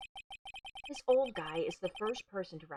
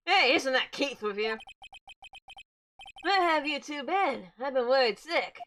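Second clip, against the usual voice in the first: a hint of a loud, fretting old man; lightly voiced grandpa scolding the kids; worried old man; 8 semitones higher, 6 dB louder than usual. Old man scolding the returning kids, loudly fretting.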